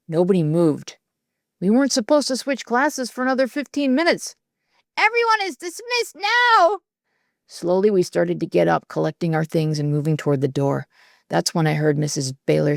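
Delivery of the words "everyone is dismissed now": much higher, slow, with a catch of hurt; firm hurt dismissal. A narrator's reading. Teacher firmly repeating the dismissal to a class that won't move. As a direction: hold back tears and insist.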